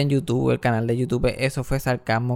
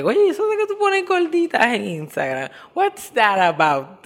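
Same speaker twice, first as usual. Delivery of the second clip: high, teasing interviewer voice, slow on the English; high-pitched mocking host who drags out the last words slowly; 9 semitones higher, 3 dB louder than usual. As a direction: play the podcast host poking fun with a sly question. Host Chente's question voiced.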